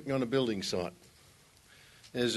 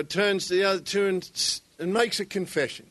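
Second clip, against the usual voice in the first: ordinary talk, nothing marked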